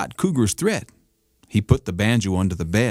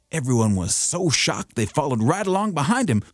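ordinary delivery, nothing marked

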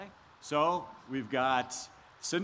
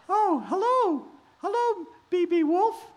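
high sweet princess voice; high girlish Cinderella; 5 dB louder than usual. Acted. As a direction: greet him in a high girlish voice. Cinderella greets the wolf.

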